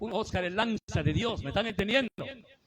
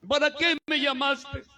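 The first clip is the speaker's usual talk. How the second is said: higher, louder pleading prayer, slowing; kneeling prayer; 7 semitones higher, 6 dB louder, slower than usual. Retold or imitated. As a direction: cry out to God at midnight on his knees. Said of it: "doblada mi rodilla". (oración suplicante de rodillas, más alta)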